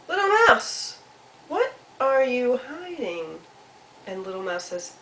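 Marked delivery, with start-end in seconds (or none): 0.1-3.4 high sing-song call, then a slow question; curious Squirrel. Acted. Squirrel asks Mouse what is hidden, high and curious. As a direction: call out nosily, stretching the question.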